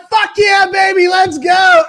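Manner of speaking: high, loud triumphant cheer; celebratory shout. Retold or imitated. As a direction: yell it like you just won a bet. Retold celebration at winning the bet.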